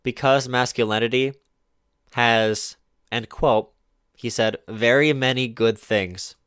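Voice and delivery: quoting him slowly, word by word; deliberately slow quote, each phrase spaced. Retold or imitated. Streamer reading the host's words back slowly and mockingly. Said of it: "His argument right here literally was".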